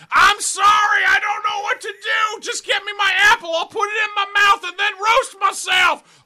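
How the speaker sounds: very high, very loud panicked pig voice; frantic hog squeal. Acted. Hog pleading for his apple, shrieking squeal.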